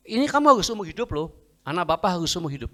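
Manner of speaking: fast, high, loud protest; incredulous doctor. Retold or imitated. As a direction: object in rapid disbelief.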